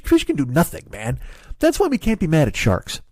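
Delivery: usual speaking voice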